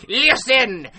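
slow, loud monster proclamation; menacing Shrieker. Acted. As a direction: confront the hunter in a hammed-up creature voice. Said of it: "speak in that voice".

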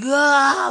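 very high, loud re-enacted scream; panicked yell. Too-high teen flipping out at his friend's house, panicked yell. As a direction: let out a stoned freak-out shriek. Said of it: "I'm, like, yelling".

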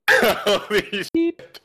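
cracking up at a bad roll; laughing disbelief. Real, not acted. Player reacts to dreaded dexterity save, laughing high.

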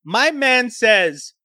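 usual speaking voice

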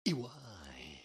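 whisper. Real, not acted. Hides the crude joke.

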